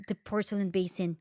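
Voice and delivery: usual speaking voice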